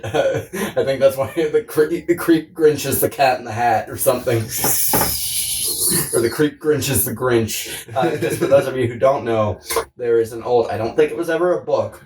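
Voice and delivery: normal talking voice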